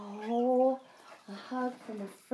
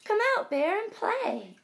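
high, bright call to come play; friends calling out; 10 semitones higher, 5 dB louder than usual. Acted. Friends calling Bear out to play, high and loud. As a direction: coax a sick friend outside, much higher and louder. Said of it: "His friends gather round".

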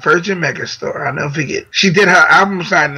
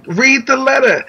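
high-pitched mock holler; retold calling out; 7 semitones higher than usual. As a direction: imitate calling to someone in a car. Shouting to car at stoplight.

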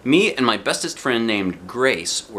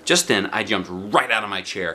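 ordinary delivery, nothing marked

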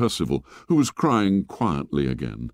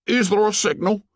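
anxious; 12 semitones higher than usual. Acted. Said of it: "said Piggy anxiously".

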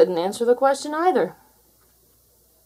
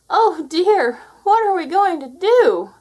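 higher, louder worried rabbits' cry; 9 semitones higher, 8 dB louder than usual. Acted. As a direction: cry out in alarm like frightened bunnies. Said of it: "they cried".

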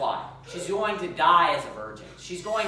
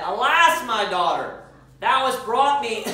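louder and higher, slow lament for his daughter; grieving father's cry; 5 semitones higher, 7 dB louder, slower than usual. Acted. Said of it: "he rent his clothes and said".

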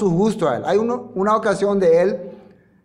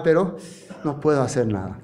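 usual speaking voice

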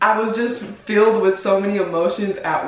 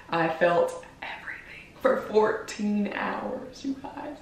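whispered; 8 dB quieter than usual. Real, not acted. Emotional memory of long labor.

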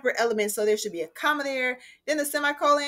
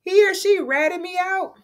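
put-on tattling kid; 10 semitones higher, 5 dB louder than usual. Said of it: "if you've ever heard the phrase".

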